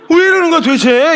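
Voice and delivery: high-pitched acted protest; mock exasperated bewilderment. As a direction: push it up high, like a put-off newcomer. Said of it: "이런 식으로 거부감이 생긴다고요". (어이없어하는 새 신자 흉내)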